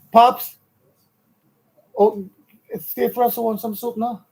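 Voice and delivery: calling out to another room; yelling across the room. Real, not acted. Calling to Pops across the house.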